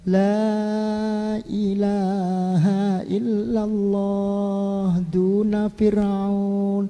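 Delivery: very slow, soft, unwavering declaration; calm steadfast answer. Acted. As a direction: say it slowly and serenely. Asia answers calmly, steadfast. (jawaban tenang dan sangat pelan)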